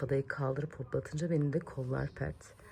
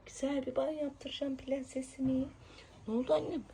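cooing; 8 semitones higher, 5 dB quieter than usual. Real, not acted. Mother cooing to her daughter about the bath. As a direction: coo it to the little princess.

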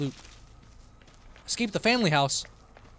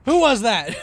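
high, loud rapid-fire questions; shouted demand; 11 semitones higher, 12 dB louder than usual. Real, not acted. Streamer to viewers, who set off the scare, high shouted demand. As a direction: demand the culprit at full volume.

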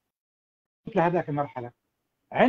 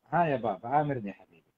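put-on soft, gentle welcome to a child; tender with a smile; 5 semitones lower, 5 dB quieter than usual. Shown as a demonstration. Father to his son, warm and smiling. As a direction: answer your little one kindly, smiling. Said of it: "بابتسامة".

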